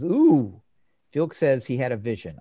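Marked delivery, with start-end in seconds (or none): 0.0-0.6 high, loud "ooh"; imitated "ooh". Host retelling Felix taking off the helmet, high loud ooh. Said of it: "takes off the helmet and says".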